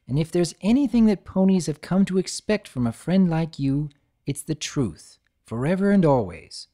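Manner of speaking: gentle warm friend; tender unicorn comfort; Twilight voice. Acted. Twilight reassures.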